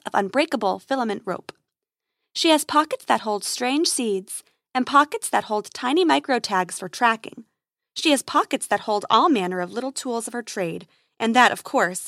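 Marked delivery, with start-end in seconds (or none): none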